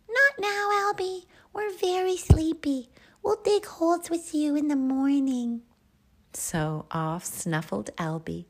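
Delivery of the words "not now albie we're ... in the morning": very high, loud small-animal voice; little meerkats. Acted. Sleepy meerkats putting Albie off, very high squeaky voice.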